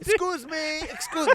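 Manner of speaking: high-pitched haughty call; mock arrogant. Arrogant guy cutting through the line, imitated haughtiness. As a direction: pitch it way up, imitating a self-important man. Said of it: "The arrogance".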